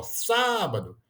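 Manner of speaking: "Sábado" with SÁ held high; called-out word, first syllable stressed. Shown as a demonstration. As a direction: lean long on the SÁ of "Sábado". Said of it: "é a mais forte, ó".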